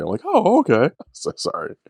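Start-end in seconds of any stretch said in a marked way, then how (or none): 0.2-0.9 high-pitched put-on viewer reaction; enlightened viewer. Host standing in for viewers learning about manga, put-on understanding. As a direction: play the viewer just catching on. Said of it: "for the viewer's point of view".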